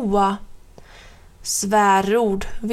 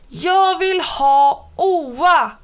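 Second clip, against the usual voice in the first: higher, louder, slow desperate scream; child screaming; 7 semitones higher, 5 dB louder, slower than usual. Acted. Sillan Per screaming for Oa, slow high scream. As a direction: wail it out like a child who won't let go. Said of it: "skriker Sillan Per".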